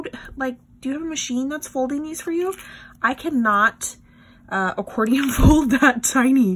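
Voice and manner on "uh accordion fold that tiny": laughing. Real, not acted. Laughs at her clumsy hands.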